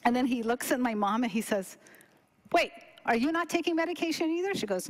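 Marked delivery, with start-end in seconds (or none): 2.5-4.5 quick, higher incredulous question; retold double-take, fast and high; imitated disbelief. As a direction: blurt it out like the startled doctor. Doctor discovers mother stopped too.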